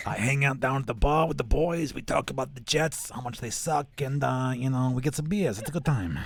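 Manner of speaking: smoker Brooklyn accent. Shown as a demonstration. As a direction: chat like a Brooklyn bar regular who smokes. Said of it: "like, the smoker Brooklyn accent".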